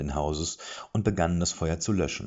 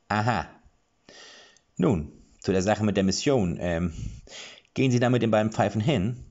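a louder, businesslike dwarf; dwarf questioning; 4 semitones higher, 4 dB louder than usual. A narrator's reading. Dwarf asks Wollmaus about the mission, louder and brisk. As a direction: get down to business.

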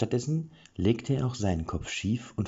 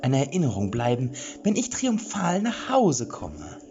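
much higher, louder boastful voice, slowing at the end; pompous lecturing falsetto; 9 semitones higher, 3 dB louder than usual. A narrator's reading. Chica Vollbart brags to the group, high, loud and pompous. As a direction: lecture them grandly about his heroism. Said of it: "dozierte er weiter".

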